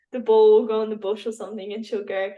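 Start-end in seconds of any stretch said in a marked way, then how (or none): none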